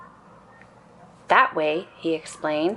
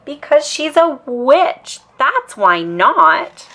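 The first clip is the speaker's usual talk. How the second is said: slow, loud, higher, matter-of-fact; insistent cubs; 8 semitones higher, 5 dB louder, slower than usual. A narrator's reading. Cubs insisting to Mama the neighbor is a witch. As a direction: spell it out as if it's obvious.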